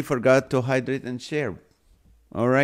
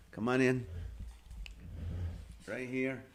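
cooing to a scared pet; 12 dB quieter than usual. Real, not acted. Coaxing dog onto lap.

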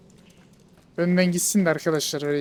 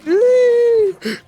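a high loud gasp of fright; sharp startled gasp; 6 dB louder than usual. Real, not acted. Streamer jolted by a jump scare in the game, gasping. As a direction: catch your breath in a sudden scare.

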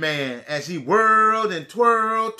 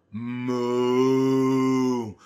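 low cow moo; 10 semitones lower than usual. Acted. Cow moos in story.

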